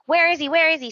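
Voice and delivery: lightly hammed-up panicked wife; mock frantic. Retold frantic wife searching.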